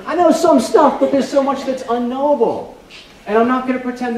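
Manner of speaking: insist, then concede the unknowable; high emphatic insistence. Real, not acted. Insisting, voice pitched up.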